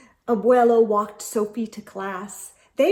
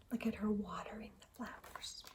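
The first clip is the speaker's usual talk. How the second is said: quick whispered aside; 2 semitones lower, 20 dB quieter, faster than usual. Acted. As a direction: lean in and whisper it to the kids.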